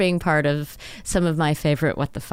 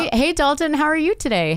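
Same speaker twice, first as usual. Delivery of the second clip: much higher, bright sing-song hello; chirpy greeting; 9 semitones higher than usual. Retold or imitated. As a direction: greet him like a cheery host. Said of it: "I'm like".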